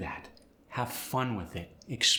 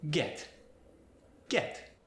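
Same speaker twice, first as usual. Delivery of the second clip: Spanish accent; 5 semitones higher than usual. Shown as a demonstration. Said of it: "that same eh sound".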